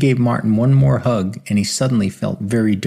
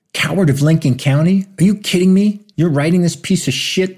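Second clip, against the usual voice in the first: higher, louder outraged demand; exploding; 8 semitones higher, 3 dB louder than usual. A narrator's reading. Martin seeing Farber's manuscript title, exploding in outrage. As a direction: blow up in disbelief. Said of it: "he exploded".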